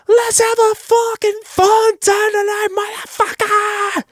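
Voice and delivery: high, drawn-out rock frontman scream; shouted command. Shown as a demonstration. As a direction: scream the order at the crowd. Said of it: "I actually like to give commands instead".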